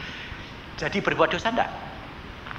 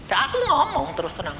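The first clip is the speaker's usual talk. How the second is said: ordinary talk, nothing marked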